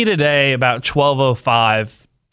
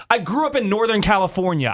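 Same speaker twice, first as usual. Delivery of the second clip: usual speaking voice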